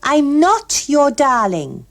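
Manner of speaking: high, loud and slow, each word firm; decisively. A narrator's reading. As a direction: shut him down flatly, no room for argument. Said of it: "she said decisively".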